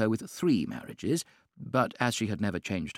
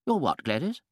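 higher, startled duchess; startled exclaim; 6 semitones higher than usual. A narrator's reading. Duchess startled by Lady Windermere, exclaiming. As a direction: jump at the strange word. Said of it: "exclaimed the Duchess".

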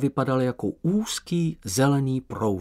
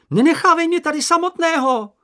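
very high, loud cry after him; desperate shout; 8 dB louder than usual. Acted. Sniff shouting after Moomin running to the sea. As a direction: yell to stop him running off. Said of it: "vykřikl Čenich".

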